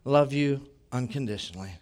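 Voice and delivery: hushed sincere reassurance; tender reassurance. Retold or imitated. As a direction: speak love softly to him. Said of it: "was to say".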